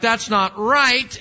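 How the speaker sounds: a dad cheated out of his cookie; mock outrage. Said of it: "and I'm like".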